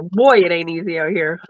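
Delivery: usual speaking voice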